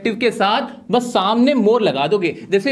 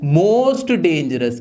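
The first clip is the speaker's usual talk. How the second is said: "Most dangerous" drawn out very slowly; slow, lower teacher demo; slow answer; 4 semitones lower, much slower than usual. Shown as a demonstration. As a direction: stretch each word for the class. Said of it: "वो क्या हो जाएगी dangerous की?".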